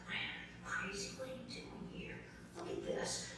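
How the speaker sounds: near-whispered scolding to herself; imitated private, whispery self-reproach; hushed self-talk. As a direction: mutter it under your breath, barely voiced. Retold panicked self-talk at critique.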